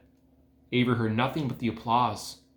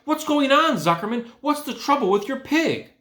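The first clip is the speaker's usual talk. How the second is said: higher, louder sudden alarm; alarmed announcer; 14 semitones higher, 5 dB louder than usual. A narrator's reading. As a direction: call out in a startled announcer voice. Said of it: "asked the loudspeaker".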